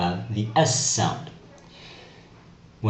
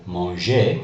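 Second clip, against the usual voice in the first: normal talking voice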